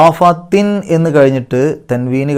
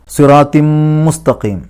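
slow, louder chant of സിറാത്തിം മുസ്തഖീം; Quran recitation; 3 semitones higher, 4 dB louder, slower than usual. Shown as a demonstration. Teacher chants the phrase before explaining. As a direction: sing it with the nun merged into mim. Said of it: "മീമിലേക്ക് ലയിപ്പിക്കുക".

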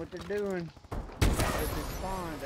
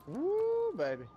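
excited whoop; 14 semitones higher, slower than usual. Real, not acted. Celebrating a kill.

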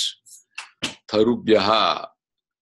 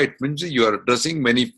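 ordinary talk, nothing marked

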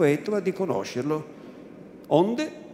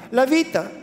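higher, louder cry of la vita; la vita cried out higher and louder; anguished cry; 8 semitones higher, 4 dB louder than usual. A narrator's reading. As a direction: break out on vita in panic. Repeated, suddenly raised.

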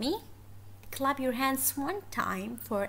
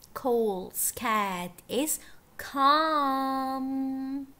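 very slow, words stretched out; slow story reading; 2 semitones higher, 5 dB louder, much slower than usual. Shown as a demonstration. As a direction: draw out each word for young learners. Teacher reads story slowly for children.

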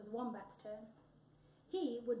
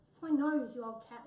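cross; 8 dB louder than usual. A narrator's reading. Wombat answering bossy Tabby back, cross. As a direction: hit the first words hard, grumpily. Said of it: "said Wombat crossly".

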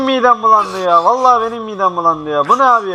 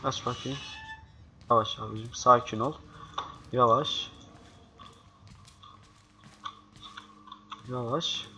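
much softer, careful low voice; hushed sneaking; 7 semitones lower, 17 dB quieter than usual. Real, not acted. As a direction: talk under breath while sneaking. Sneaking past enemy, hushed. (sessizce, temkinli)

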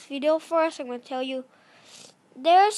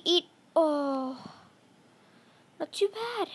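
usual speaking voice

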